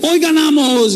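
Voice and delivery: high mock fan yell. German fans on the train yelling a boast, mild mock yell. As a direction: shout like a confident fan, lightly.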